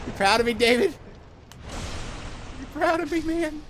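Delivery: very high, loud put-on squeal; mock seeking approval. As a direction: call out in a silly high voice, fishing for praise. Playfully begging absent friend for approval.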